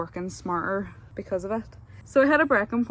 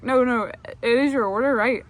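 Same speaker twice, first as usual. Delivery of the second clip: insistent bartender; 4 semitones higher, 8 dB louder than usual. Retold or imitated. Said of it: "he's like".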